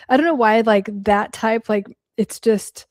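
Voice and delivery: ordinary talk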